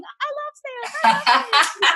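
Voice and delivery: high chant through a laugh; happy clients chanting together; gushing clients. Retold or imitated. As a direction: cheer it over and over, laughing. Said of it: "my clients going".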